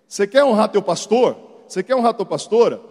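loud preaching appeal; full-force preaching. Acted. Bishop exhorting the congregation, loud preaching. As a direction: drive the point home to the church.

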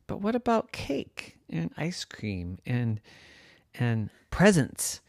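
soft, slow tempting; coaxing teacher. A narrator's reading. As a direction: lure her gently with treats.

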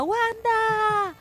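high pitched screeching "Wanda!" like a fan; mock fan cry calling out Wanda; imitated fan shriek. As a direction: shriek the name like an obsessed fan. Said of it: "te pone fan y te grita".

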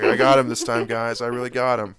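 mock gloating, loud; imitated Madison. Speaker voicing friend's little brain, mock gloating. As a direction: loudly mimic a friend's self-satisfied boast. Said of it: "In her, in her little Madison brain, she's like".

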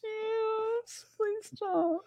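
very high, soft laughing plea. Real, not acted. Begging the creepy co-host to stop, laughing plea. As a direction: beg through helpless giggles.

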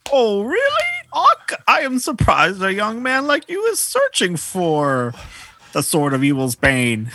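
booming ancient sage proclaiming; old man voice. Acted. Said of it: "I'll be the old man voice".

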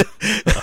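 high burst of laughter; high giggle. Real, not acted. Laughing about pranking a friend on the podcast, high giggle. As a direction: crack up at your own joke.

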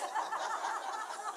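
a short high breathy laugh at his own joke; preacher laughing at his youth van joke; real laugh. Real, not acted. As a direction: laugh it off lightly.

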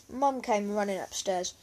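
usual speaking voice

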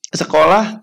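ordinary talk

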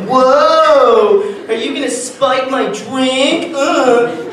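slow, high, eerie comedian voice; ghost voice. Retold or imitated. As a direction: spook it out like a stage ghost. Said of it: "the stereotypical jokes of, like".

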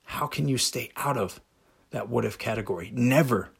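ordinary talk, nothing marked

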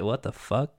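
hushed, low under-the-breath what the fuck; stunned disbelief. Retold or imitated. Reacting to the show's plot twist, hushed and baffled. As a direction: mutter it half to yourself, baffled. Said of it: "and it's like".

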